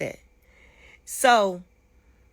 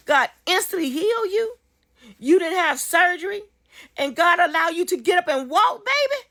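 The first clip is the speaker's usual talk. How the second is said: higher and rising with joy; excited rejoicing; 8 semitones higher, 3 dB louder than usual. Retold or imitated. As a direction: lift each line higher in praise. Rejoicing at the healing, retold.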